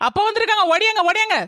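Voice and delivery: high-pitched urgent summons; excited calling out. Acted. Wife calls husband because father has come, high excited call.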